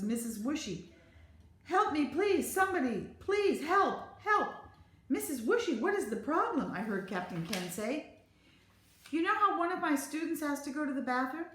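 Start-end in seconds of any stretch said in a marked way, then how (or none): 1.6-4.5 high, loud pleading in bursts; frantic calls for help. A narrator's reading. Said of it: "louder and louder". 5.1-6.7 higher-pitched, pressing question; concerned captain. A narrator's reading. Captain answers frantic teacher. 9.1-11.5 high-pitched, anxious explaining; worried teacher. A narrator's reading. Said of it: "I'm really worried".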